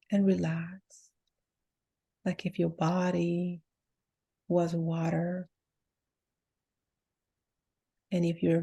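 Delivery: softly spoken meditation with long pauses; guided meditation. Real, not acted. Healer telling listeners to let the body drop and relax, guided meditation voice. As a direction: lead them into relaxation, letting each phrase drop and settle.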